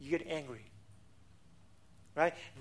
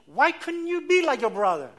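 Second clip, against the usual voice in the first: raised, louder scolding comparison; scolding a child with a sibling comparison, loud and high; nagging parent; 7 semitones higher, 8 dB louder than usual. Retold or imitated. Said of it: "you say".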